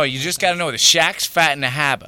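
Shaq's fat in the harbor in a light Boston accent, r's dropped. Shown as a demonstration. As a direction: say it like a Boston local. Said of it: "you're Boston".

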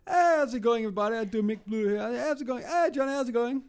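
high, loud cheery greeting voice; Mick voice. Shown as a demonstration. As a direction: do Mick's accent, bright and loud. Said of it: "talk to Mick in your Mick voice".

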